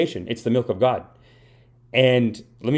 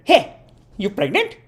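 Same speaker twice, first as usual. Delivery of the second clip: blunt mom; 13 semitones higher than usual. Retold or imitated. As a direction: imitate a tactless mother calling out a question, pitched up. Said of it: "my mom sees her at a party".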